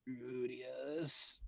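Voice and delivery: put-on soft, weak girl calling; Eris voice. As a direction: call his name weakly.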